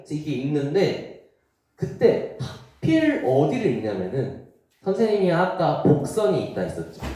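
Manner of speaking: usual speaking voice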